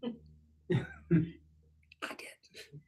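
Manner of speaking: whispered, barely there. Retold or imitated. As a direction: confess it in a secret whisper. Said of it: "Nicodemus is like".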